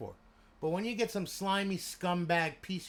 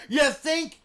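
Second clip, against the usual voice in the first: loud, much higher sarcastic jab; 13 semitones higher, 13 dB louder than usual. Retold or imitated. Telling off the guy who insulted Ian, loud and sarcastic. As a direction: throw it back at him, mocking loudly. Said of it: "I'm, like, going, like".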